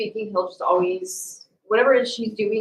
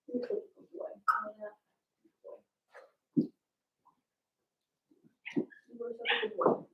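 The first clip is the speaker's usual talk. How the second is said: pet-talk praise; 13 semitones higher, 10 dB quieter than usual. Real, not acted.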